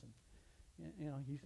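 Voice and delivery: usual speaking voice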